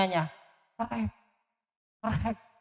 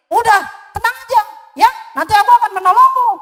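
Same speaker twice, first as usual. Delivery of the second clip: much higher, fast, louder reassuring mouse; 11 semitones higher, 6 dB louder, much faster than usual. Acted. Mouse reassures the lion in a fast high squeak. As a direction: rattle it off like a busy little mouse.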